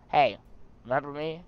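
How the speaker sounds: ordinary talk, nothing marked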